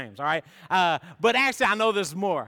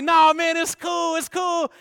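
higher, loud mock-egging on; shouted reassurance; 10 semitones higher, 6 dB louder than usual. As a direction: shout it's cool to hype him up. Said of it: "and they're like".

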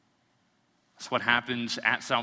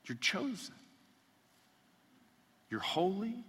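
soft, higher, reassuring words; tender assurance; 7 semitones higher, 5 dB quieter than usual. Acted. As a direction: gently reassure each listener. Tender assurance after loud passage.

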